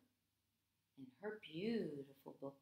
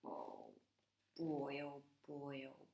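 dreading groan; 5 semitones lower, 7 dB quieter than usual. A narrator's reading. Narrator groaning at the duck's bagpipes. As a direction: sigh it out with comic dread.

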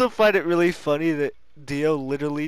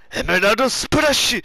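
a hint of an anime battle cry; anime attack shout; 7 semitones higher, 6 dB louder than usual. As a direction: call out the attack.

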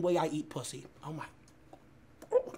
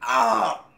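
pained yelp; 6 semitones higher, 8 dB louder than usual. Real, not acted. Eating the spicy wing.

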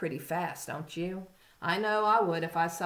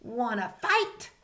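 grouchy ladybug; 5 semitones higher than usual. A narrator's reading. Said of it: "said the grouchy ladybug".

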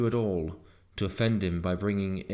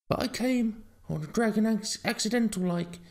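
high-pitched, pleading dog voice; frightened dog; 14 semitones higher than usual. A narrator's reading. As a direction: explain yourself as a scared little dog. Frightened dog explains to farmer.

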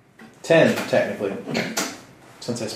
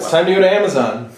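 quick, higher, louder rallying call; rallying the group; 6 semitones higher, 5 dB louder, faster than usual. Acted. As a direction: hurry the group along in character. Player character rallies the group.

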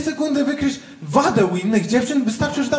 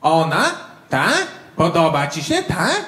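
put-on jealous girlfriend; 3 semitones higher, slower than usual. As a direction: needle him, climbing into shrill squeaks. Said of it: "zaczęła robić takie".

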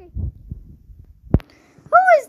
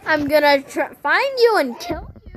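psychopath voice; 6 semitones lower, 3 dB louder than usual. Acted. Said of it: "you sound like you're about to murder me".

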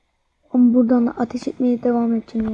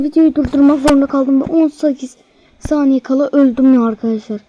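ordinary talk, nothing marked

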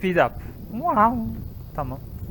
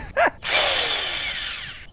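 long high laugh. Real, not acted. Laughing at own mistake.

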